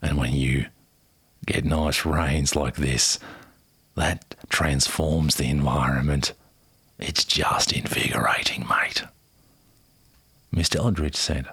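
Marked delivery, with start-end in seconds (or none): 0.0-9.0 put-on a dramatic Aussie accent, lower and slow. Quoting the ranger on the drought, dramatic Aussie accent. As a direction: play the weathered outback ranger. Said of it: "dramatic Aussie accent for you".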